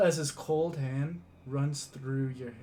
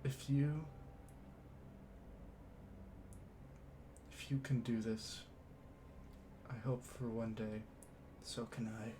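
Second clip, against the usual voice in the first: quiet father; 4 semitones lower, 12 dB quieter than usual. Acted. Father speaks to his child.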